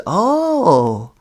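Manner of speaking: mock-knowing drawn-out "oh"; sarcastic teacher savoring the news. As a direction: tease with a long, swooping reaction.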